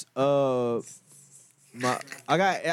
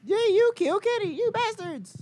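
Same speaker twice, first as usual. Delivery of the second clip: high squeaky cartoon cry; South Park quote. Friend quoting South Park in a high cartoon voice. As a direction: shriek it like the cartoon kid. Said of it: "that was a South Park reference?".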